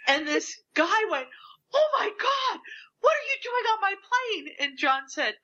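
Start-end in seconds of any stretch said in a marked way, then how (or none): 1.7-4.6 high-pitched mock astonishment; imitating a flabbergasted plane passenger; astonished passenger. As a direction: act out a stunned fan, pitch way up. Retold startled passenger meets celebrity.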